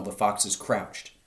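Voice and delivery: ordinary delivery, nothing marked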